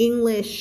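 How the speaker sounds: English ending in a long hushing shhh; long final sh. Shown as a demonstration. Teacher shows the hush sound on the end, English with long sh. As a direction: add a drawn-out sh to the end of English. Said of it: "แล้วเอามาใส่ข้างหลัง".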